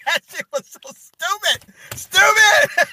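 high-pitched helpless laughter through the words; squealing with laughter while talking; cracking up. Real, not acted. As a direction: lose it laughing at your own joke. Cracking up at his own imitation.

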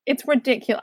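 usual speaking voice

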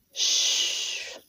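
soft breathy shush; hushing shh. Acted. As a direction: hush him with a finger to his lips. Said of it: "I said, putting my finger up to your lips".

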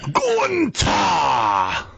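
put-on shouted announcer call in German accent; fake German accent. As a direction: belt out the name with a German accent. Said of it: "I have never developed a fake German accent".

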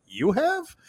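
high-pitched retold disbelief; incredulous reaction. Host retelling his reaction to an unlikely angler's claims, incredulous. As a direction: voice jumps up in doubting surprise.

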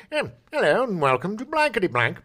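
mock British host accent; British game show host. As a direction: welcome them like a British TV host. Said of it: "Super British".

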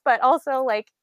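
normal talking voice

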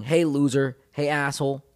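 half-hearted imitation of yelling; imitated yell. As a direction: call it out like a street heckler. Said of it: "someone's yelling".

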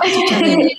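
ordinary delivery

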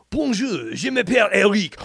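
high, loud, hammed-up French accent for Bonjour, je m'appelle Eric; showing off to the class in an exaggerated French accent; jokey French accent. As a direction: play the class clown in a put-on French accent. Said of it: "I was like".

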